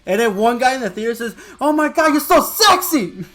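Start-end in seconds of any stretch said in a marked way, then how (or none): 1.6-3.2 loud, high imitated holler; mock fan outburst, slowing at the end; gushing fan shout. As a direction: shout it like a smitten moviegoer, stretching the end. Imitating man shouting in theater.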